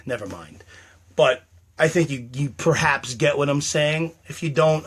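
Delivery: ordinary talk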